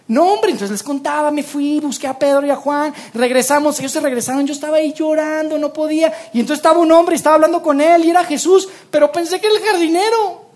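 high, excited, laughing at the end; María laughing as she retells the gardener mix-up, high and eager; laughing retelling. Acted. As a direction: tell it with a laugh at herself.

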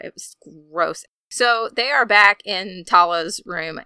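usual speaking voice

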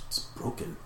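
hushed, near-whispered realisation; softly, as a private thought; thinking to itself. Acted. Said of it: "it thinks to itself".

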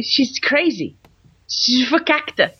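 slow, drawn-out mock Yiddish; put-on Yiddish word.

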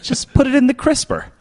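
very high squeaky voice; comic put-on voice. Guest jokes about the mini fridge, high silly voice. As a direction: pipe it up high for a joke.

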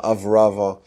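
ordinary talk, nothing marked

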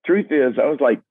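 half-laughing frustration, voice climbing high; mock-desperate, voice way up; exasperated. Real, not acted. Exasperated truth behind sweet song.

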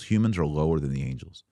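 usual speaking voice

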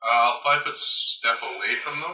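ordinary talk, nothing marked

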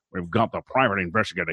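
quick, hammed-up noir narrator; booming old melodrama narrator. Shown as a demonstration. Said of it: "I feel it should be".

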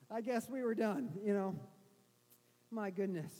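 normal talking voice

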